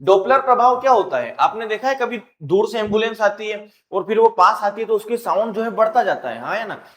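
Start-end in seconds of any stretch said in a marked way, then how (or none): none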